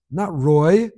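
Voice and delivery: put-on light Israeli accent, correcting. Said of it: "with a thick Israeli accent".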